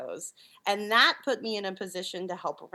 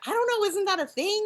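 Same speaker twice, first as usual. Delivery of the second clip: higher, quick and unsure; unsure high reply; 12 semitones higher, 3 dB louder, faster than usual. Retold or imitated. Guest answers her boss, unsure and high-pitched. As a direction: toss it up high, doubtful. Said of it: "And I'm like".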